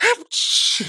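a sudden explosive sneeze; a comic high sneeze. A narrator's reading. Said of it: "чихнув, сказал Нисачих".

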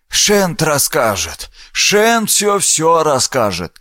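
put-on a sly old man pleading for coins. Old man begs a coin.

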